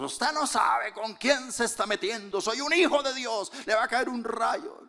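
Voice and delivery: high, mocking self-righteous threat. Preacher mocking believers who threaten others, in a high mocking voice. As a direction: ham up a pious bully's threat. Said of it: "A veces decimos eso".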